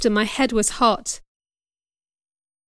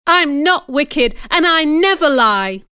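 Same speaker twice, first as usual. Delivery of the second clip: higher, louder indignant protest; crying out; 5 semitones higher, 5 dB louder than usual. A narrator's reading. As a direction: cry it out in angry denial. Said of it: "I cried".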